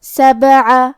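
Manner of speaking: سبعہ with the ب moved, not held silent; tajweed demo. Shown as a demonstration. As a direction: say سبعہ slowly, rising at the end. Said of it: "یہ کلمہ کو پڑھنا ہے".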